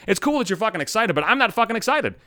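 ordinary talk

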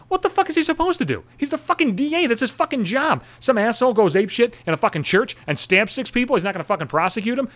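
high-pitched, incredulous outrage; indignant rant. Real, not acted. Ranting at a DA boasting of prosecutions, indignant. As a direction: shoot up high in exasperated disbelief.

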